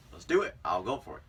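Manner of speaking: a quick excited shout of resolve; pumped-up decision. Retold or imitated. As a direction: hype yourself up to go for it. Psyching himself up to ask.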